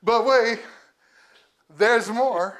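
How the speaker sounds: loud, punchy sales pitch; infomercial pitchman. Acted. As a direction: sell it like a TV ad. Infomercial catchphrase while unboxing gifts.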